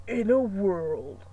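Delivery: mock epic trailer narrator, slow; a lightly done "in a world" trailer intro; hammed-up trailer announcer; movie trailer voice. Shown as a demonstration. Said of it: "I'm not that good at that".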